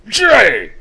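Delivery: very loud, high shout; imitated yell. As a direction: mimic a man's insistent third call. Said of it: "the third time I heard".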